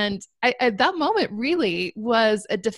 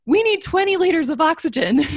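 high, louder urgent call; retold yell; 8 semitones higher, 6 dB louder than usual. EMT yells to the helicopter pilot, urgent and loud. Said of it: "She yells at the pilot".

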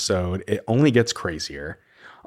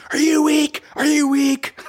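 much higher, shrill goading challenge; Dorinda's pep talk. Retold or imitated. Dorinda trying to give crying Sonia an emotional boost, very high and pushy. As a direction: goad her like a drill sergeant. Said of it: "crazy Dorinda... is like".

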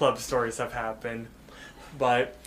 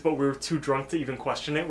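ordinary talk, nothing marked

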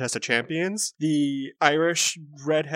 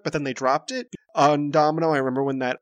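usual speaking voice

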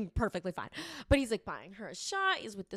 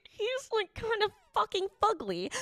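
much higher, squeaky disgusted aside; horrified disgust; 11 semitones higher than usual. Retold or imitated. Realizing the guy in the bar is ugly, high appalled squeak. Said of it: "I'm like".

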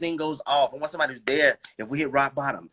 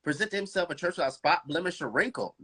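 preaching; 5 semitones higher, 3 dB louder than usual. Acted. Host quotes scripture to his guest like a preacher. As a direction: preach the verse like from the pulpit. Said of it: "You preaching".

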